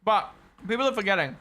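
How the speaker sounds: talking with a mouthful; wolfing down food between words; mouth full. Real, not acted. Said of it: "Sorry for eating as well".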